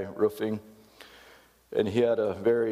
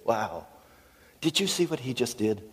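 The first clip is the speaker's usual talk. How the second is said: higher-pitched onlooker's wonder; mock amazement; 4 semitones higher than usual. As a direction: marvel at it like an impressed onlooker. Acted onlookers amazed at the kid.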